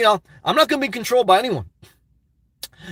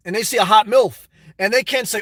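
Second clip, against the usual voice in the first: normal talking voice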